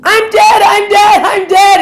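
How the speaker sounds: put-on very high, loud toddler shouting with glee; excited toddler. Toddler pointing up tells the tour, excited high shout. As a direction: shout it like an overexcited little kid. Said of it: "he was, like, really excited to tell us that".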